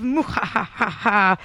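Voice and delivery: slow, low villain cackle; evil laugh. Pretend. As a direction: mad scientist laugh. Evil laugh at Bart alive.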